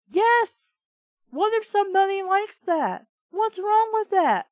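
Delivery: high, loud and incensed; indignant outburst. Real, not acted. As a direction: fire back at the insult, outraged. Narrator's inner outburst at Marco.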